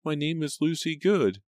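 high, timid maid; high-pitched servant girl; quiet reply. A narrator's reading. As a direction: answer meekly in a small, high voice. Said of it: "the maid replied quietly".

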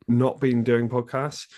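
ordinary talk

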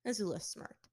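ordinary delivery, nothing marked

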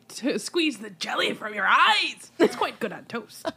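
slow Scottish ogre voice; Shrek voice. Retold or imitated. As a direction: growl it out like Shrek. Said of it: "And he's like".

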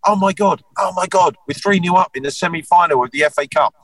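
high, excited mock celebration; excited cry. Cousin celebrating three nil up at half time, excited high voice. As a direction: squeal it out with joy. Cousin dancing in dressing room.

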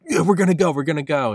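loud, high-pitched excited chant; hyped up. Acted. Driver recalling an inside joke, hyped up. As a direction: whoop it out in a rush of enthusiasm. Acting out renewed excitement for trip.

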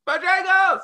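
high, loud shouted call from below; kids yelling up the stairs. Retold or imitated. Kids shouting the safe word up at arguing parents, loud high yell. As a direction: yell it like a kid calling from downstairs. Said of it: "we hear them from downstairs".

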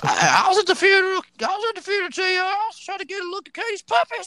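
very high, squealing commentator impression; mock commentator squeal. King needling J R over the Katie Vick angle, high mock squeal. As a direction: squeal it like an overexcited heckler. Mimics Jerry Lawler heckling J R.

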